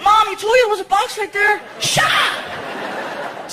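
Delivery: put-on high, whiny kid voice; little kid. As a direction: tattle like a small boy. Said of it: "Even Frankie was like".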